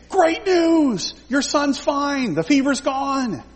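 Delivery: high, thrilled announcement; excited good news. Retold or imitated. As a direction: burst out with the joyful news. Servants announce son is healed.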